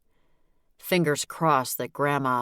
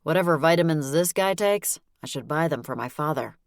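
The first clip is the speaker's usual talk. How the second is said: usual speaking voice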